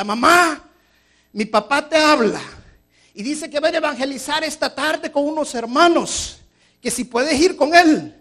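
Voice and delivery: high, loud call from the next room; child calling out. Acted. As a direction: shout it like a boy to his mom. Child calls mother in story.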